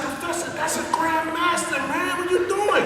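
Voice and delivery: retold shock, voice jumping way up; startled protest. Telling his teacher he can't challenge a grandmaster, high and shocked.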